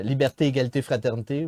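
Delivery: "liberté, égalité, fraternité" in French with French vowels and final stress; French motto, lightly accented; French pronunciation. Shown as a demonstration. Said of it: "we say in French".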